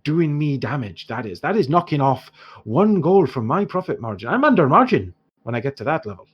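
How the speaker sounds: Irish dwarf trader, mock-pained; aggrieved dwarf shopkeeper. Dwarf knocks off one gold, hammed-up Irish grievance. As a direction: haggle as though every coin hurts.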